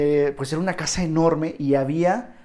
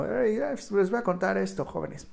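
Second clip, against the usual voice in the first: put-on higher, shaky old voice; old lady voice; 5 semitones higher, 4 dB quieter than usual. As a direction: play a frail old granny. Mock old self in asylum.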